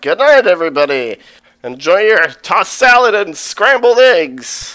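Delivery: loud, high, fast jolly send-off; boisterous king. Retold or imitated. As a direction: shout it like a merry drunk king. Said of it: "and is like".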